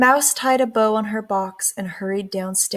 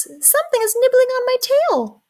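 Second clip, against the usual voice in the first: high, louder mouse squeak; a tiny mouse squeaking in alarm; high squeaky startled complaint; 4 dB louder than usual. A narrator's reading. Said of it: "squeaked Mouse".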